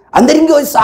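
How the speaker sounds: imitated exasperated yell at the congregation; mock scolding shout. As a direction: bark it like the hot-headed young preacher. Retelling his younger self scolding.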